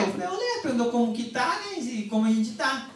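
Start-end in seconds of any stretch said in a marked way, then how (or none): 0.3-0.6 high, soft little "Eu leio"; high mock reply. Telling his friend he reads, high and soft. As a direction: replies high and lightly softened.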